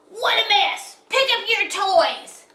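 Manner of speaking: loud, high exasperated scolding; yelling. A narrator's reading. Gertie Toad yelling at her toadlets, loud scolding. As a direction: holler at messy kids. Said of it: "he heard her yelling inside".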